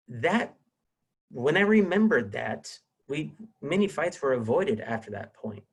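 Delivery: usual speaking voice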